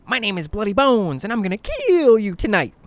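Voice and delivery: loud, high taunting bogeyman voice; menacing bogeyman. Acted. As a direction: play the bogeyman for a campfire scare. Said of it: "Art leaned in".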